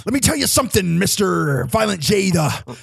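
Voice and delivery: high, shouted wrestling promo rhymes; [name] wrestling promo voice. Shown as a demonstration. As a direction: cut a rowdy wrestling promo, rhyming. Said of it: "kinda like this one right here".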